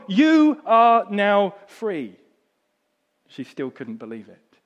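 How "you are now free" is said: high, loud and very slow proclamation; loud slow declaration. Acted. Englishman proclaiming her freedom, loud and drawn out. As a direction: proclaim it to the whole street.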